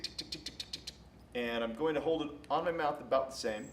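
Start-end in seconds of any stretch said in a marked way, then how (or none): 0.0-0.9 soft unvoiced tongue ticks; whispered tick sounds. Shown as a demonstration. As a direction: click out quiet ticks. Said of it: "make a tisk sound".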